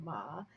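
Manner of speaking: "ma" low, with a dip and rise; third tone. Shown as a demonstration. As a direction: scoop "ma" down and back up. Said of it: "that's third tone".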